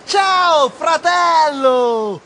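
high, loud, stretched greeting; loud shout. Acted. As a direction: bellow the hello. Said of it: "urlò a squarciagola".

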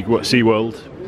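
ordinary delivery

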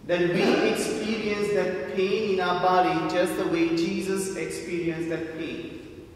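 ordinary delivery

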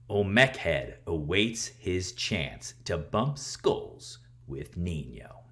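plain reading voice

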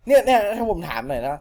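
plain reading voice

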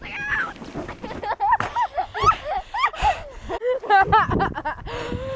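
giddy rising laugh; breathless laugh of relief; high laughter. Real, not acted. After the scary run.

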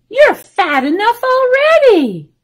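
put-on high, loud protesting old lady. A narrator's reading. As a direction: exclaim it as an affronted storybook old lady. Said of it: "said the old lady".

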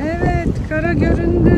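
loud, high playful sailor's call; mock land ahoy. Spotting the pool below, a loud playful land-ahoy call.

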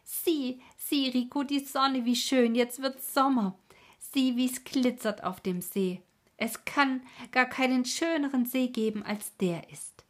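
high, loud burst of delight; joyful exclamation. A narrator's reading. Stineli showing Rico the glittering lake, exclaiming joyfully. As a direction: call out brimming with joy. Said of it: "rief es sie aus".

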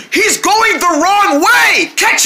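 drawn-out panicked yell; panicked shout. Acted. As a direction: warn them he's off course. Friend falling past.

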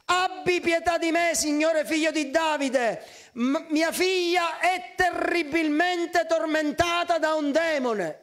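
high, drawn-out plea for mercy; dramatic pleading outcry; crying out. Acted. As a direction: cry slowly and insistently for help. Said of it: "si mise a gridare".

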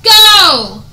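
loud, high screaming rage; furious yell. Real, not acted. Gamer screaming at the stuck horse, furious.

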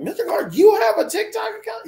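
high, loud, shocked student voice; mock the kids squealing in disbelief; imitating students. Teacher imitating his students' shocked shout. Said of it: "my students are like".

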